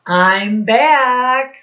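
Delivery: lightly voiced bus driver calling out; bus driver returning.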